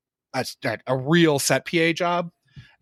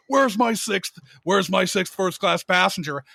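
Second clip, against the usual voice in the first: higher, demanding bark over the walkie; retold impatient yelling; 4 semitones higher than usual. AD over walkie demanding his sixth passenger, impatient yell. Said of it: "I'm getting yelled at".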